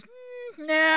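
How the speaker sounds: offhand high "mm", then a lazy "nah"; a casual, unbothered refusal; dismissive God. Pretend. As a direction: brush the request off, pitch jumping up on "mm". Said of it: "he goes".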